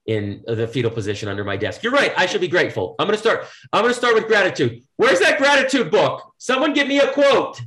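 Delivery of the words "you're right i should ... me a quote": higher, hammed-up eager voice; mock eager convert. Worker talks himself into gratitude, higher and hammed-up. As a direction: play a sudden gratitude convert, over the top. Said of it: "mock resolve in fearful voice".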